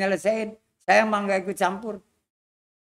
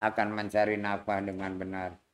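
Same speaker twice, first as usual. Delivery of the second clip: put-on gentle old man; 12 semitones lower, 4 dB quieter than usual. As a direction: reason softly like a wise elder. (suara kakek rendah, lembut dan pelan)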